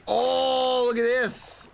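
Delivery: real high-pitched delight; delighted discovery. Real, not acted. Spots a hot spring in game.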